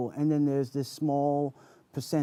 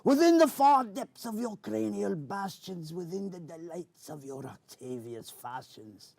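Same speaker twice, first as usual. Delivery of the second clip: Captain Barbossa pirate voice; 4 semitones higher than usual. Acted. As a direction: declaim like a swashbuckling pirate. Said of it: "characters was Captain Barbosa".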